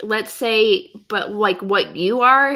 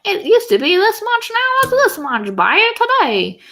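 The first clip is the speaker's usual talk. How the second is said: high, loud hammy ad announcer; cheesy sale ad; 6 dB louder than usual. Retold or imitated. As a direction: sell it like a cheesy ad. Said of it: "cheesy sale ads where it's like".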